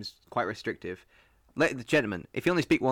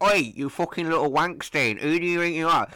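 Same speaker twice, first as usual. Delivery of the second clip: mock rough Rochdale; 6 semitones higher, 5 dB louder than usual. Mocking people who tease her mum, in a higher, louder Rochdale accent. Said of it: "speaks like what you just gave an example".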